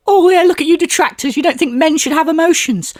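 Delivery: high-pitched mock indignant retort; mocking imitation. Imagined retort to detractors, mockingly indignant. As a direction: put on a high, whiny mocking voice.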